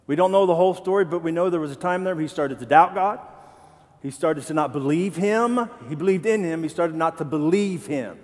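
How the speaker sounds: normal talking voice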